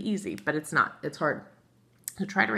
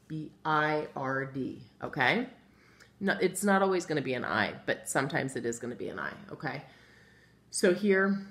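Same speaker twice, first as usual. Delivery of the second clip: ordinary delivery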